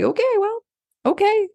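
high, sing-song give-up okay; mock resignation. Parent to stubborn kid, mock high resigned okay.

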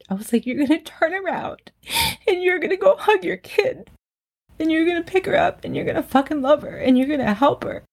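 real tearful voice, pitched high and straining; choked up, holding back tears while telling it; getting emotional. Real, not acted. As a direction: speak through welling tears, voice climbing high. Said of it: "I'm gonna get emotional now".

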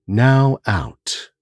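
slow, measured count with long pauses; guided breathing meditation. Acted. Narrator settling listeners before tonight's tale, guided meditation. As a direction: guide them gently through each breath.

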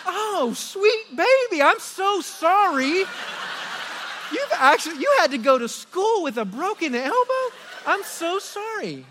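high, slow, over-the-top sorry voice; laughing mock baby talk, slow and high; gushing apology. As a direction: coo apologies at the hurt kid way up high. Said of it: "And I'm like".

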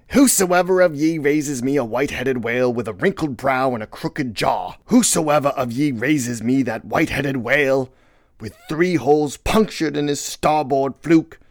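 rousing, declaimed captain's speech; high, forceful proclamation; Ahab. Acted. Ahab rallying his crew.

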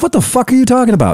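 retold high, fast disbelief; baffled outburst. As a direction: blurt it out in disbelief. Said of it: "And I'm like".

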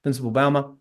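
usual speaking voice

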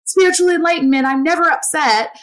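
lifted, mock-serene self-description; mock spiritual serenity. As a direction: lightly put on a never-upset spiritual voice. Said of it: "which we can do with this, like, spiritual enlightenment".

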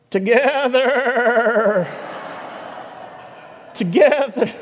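high, loud, drawn-out bleat that breaks into a laugh; bleating sheep. Acted. First sheep shouts along with its friend in a high, loud bleat. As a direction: stretch it into a sheep's bleat, then crack up laughing. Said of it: "So the first sheep goes".

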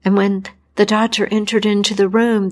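ordinary delivery, nothing marked

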